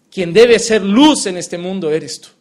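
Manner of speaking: usual speaking voice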